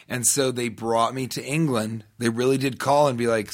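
normal talking voice

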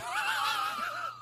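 very high squealing laugh. Real, not acted. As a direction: crack up in a soft, high squeal. Interviewer cracking up.

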